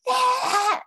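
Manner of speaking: very high, drawn-out exultant yeah; triumphant yeah. Retold or imitated. After telling the lady off, exultant high yeah. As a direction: crow it like you won. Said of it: "exhilarated and drunk and high".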